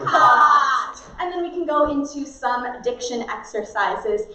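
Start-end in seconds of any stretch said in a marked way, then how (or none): 0.0-1.0 loud, long, stretched-open word; big apricot. Shown as a demonstration. Drama teacher warms up the mouth, big drawn-out shout. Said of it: "then go big with".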